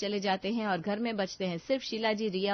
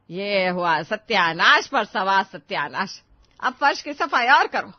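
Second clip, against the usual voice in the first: slow, much louder exasperated shout; furious scolding; 10 dB louder, slower than usual. Acted. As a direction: bellow at the mess on the floor. Plate dropped, mother-in-law erupts.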